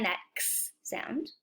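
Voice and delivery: that x sound, x as a soft ks hiss. Shown as a demonstration. Teacher pointing out the ks in axe, softly. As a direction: whisper x as ks. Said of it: "There it is again".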